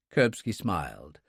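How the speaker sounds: usual speaking voice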